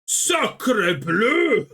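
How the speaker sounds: put-on hammy French chef accent. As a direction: shout it like a cartoon French chef. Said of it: "French exclamation mocking French strikers".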